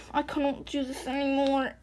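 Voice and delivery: ordinary talk, nothing marked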